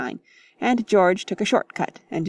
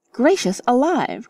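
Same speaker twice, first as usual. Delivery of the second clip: startled cry; 5 semitones higher than usual. Acted. Missus Honeychurch sees naked young men, startled cry. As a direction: gasp at the sight. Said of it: "cried Missus Honeychurch".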